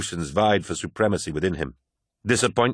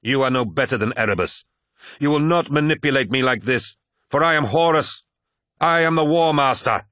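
snarling; 5 semitones higher than usual. A narrator's reading. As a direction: assert your rank in rising anger. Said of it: "snarled Horus".

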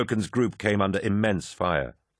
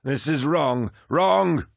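high cry building to a louder last word; despairing roar; 8 semitones higher, 4 dB louder, much slower than usual. A narrator's reading. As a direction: roar in despair, voice near cracking. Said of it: "his voice cracking with despair".

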